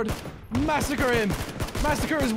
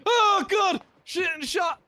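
high, startled cursing; alarmed shout; 6 semitones higher, 4 dB louder, slower than usual. Real, not acted. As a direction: yell out in alarm. Caught by enemy, dying.

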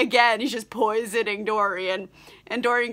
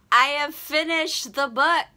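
higher put-on proclamation; mock-grand announcement; 6 semitones higher than usual. Reader reporting back on the book, mock-grand. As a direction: announce it with playful pomp, pitched up.

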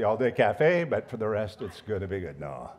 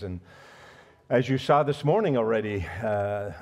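normal talking voice

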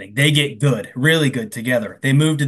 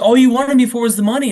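mock accusation; 7 semitones higher, 5 dB louder than usual. [name] accuses Brian.

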